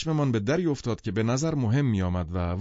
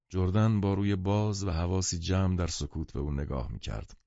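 ordinary delivery

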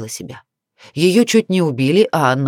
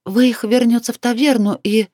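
higher-pitched female character voice; woman's anxious reply; 7 semitones higher than usual. A narrator's reading. Cornered woman tries to answer the angry man, high female voice.

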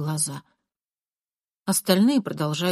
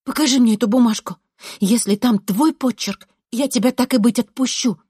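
raised, pressing bargain; insistent demand; 5 semitones higher, 5 dB louder than usual. A narrator's reading. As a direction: press him to hand it over. Narrator pressing boy with offer. (настойчиво, с нажимом)

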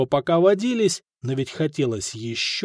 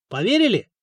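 sceptical question; 7 semitones higher, faster than usual. A narrator's reading. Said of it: "поднял бровь Коста".

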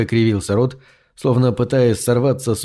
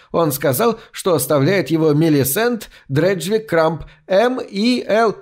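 higher crone's shriek; crone yelling; 5 semitones higher than usual. A narrator's reading. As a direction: squeal her own name. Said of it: "завопила костлявая баба". (завопила костлявая баба, высоко)

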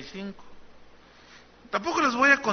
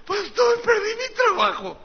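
distressed congregant; 5 dB louder than usual. Acted. Congregant voiced complaining to pastor.

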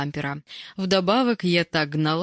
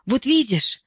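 high, louder triumphant delight; 8 semitones higher, 5 dB louder than usual. A narrator's reading. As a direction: show you were right, delighted. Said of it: "обрадовалась Ирка". (обрадованно)

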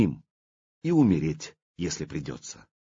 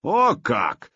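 amused surprise; 5 semitones higher, 3 dB louder than usual. Acted. Vladimir amused.

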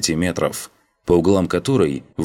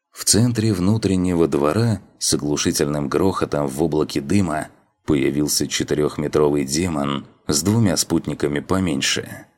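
plain reading voice

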